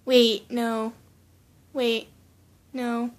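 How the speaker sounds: ordinary delivery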